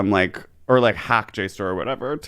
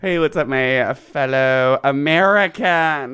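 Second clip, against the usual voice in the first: mock patriotic voice; 3 semitones higher, slower than usual. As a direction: drawl it like a flag-waver.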